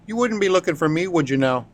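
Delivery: a teasing sailor taunting in a Gaelic lilt; thick Gaelic accent. Acted. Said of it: "in his thick Gaelic accent".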